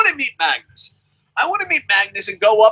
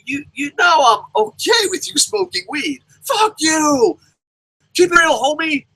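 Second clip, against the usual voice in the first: mock angry shout; 7 semitones higher than usual. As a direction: yell it like a man losing it online. Magnus voiced ranting on Facebook.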